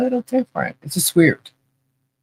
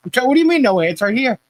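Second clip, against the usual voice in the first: higher, playful what do you mean; teasing retort; 7 semitones higher, faster than usual. Retold or imitated. Wife teasing Joe that the gifts are right there, higher and playful. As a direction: poke fun at his disbelief.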